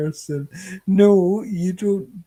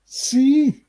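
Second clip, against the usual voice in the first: triumphant; 10 semitones higher, 6 dB louder than usual. Real, not acted. Triumphant teasing after chat votes.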